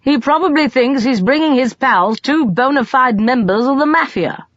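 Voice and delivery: high, biting sarcasm; mild bitter grumble from the back. A narrator's reading. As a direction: sneer at the idea. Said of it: "Colin said bitterly".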